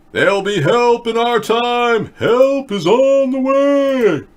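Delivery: high, loud, drawn-out proclamation; mock rally cry. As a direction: holler it slowly like a hammed-up campaign slogan.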